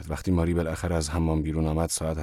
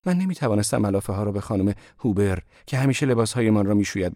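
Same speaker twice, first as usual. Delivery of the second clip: put-on higher and louder young woman, rushing; young woman's voice climbing higher and louder; Marie, growing agitated; 7 semitones higher, 4 dB louder than usual. A narrator's reading. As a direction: Marie pouring out her worry. Marie explains, rising agitation.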